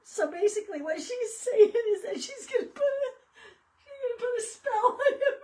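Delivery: barely getting words out for laughing; laughing to tears. Real, not acted. Explaining the hex threat, crying with laughter. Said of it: "I'm about dying laughing".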